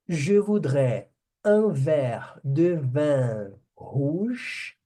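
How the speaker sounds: slow, deliberate French sentence; slow teacher demo. Shown as a demonstration. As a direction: spell it out slowly for students. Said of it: "teacher models phrase".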